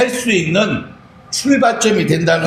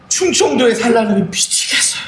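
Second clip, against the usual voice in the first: exasperated cry; 4 semitones higher than usual. Retold or imitated. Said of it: "막 울어요".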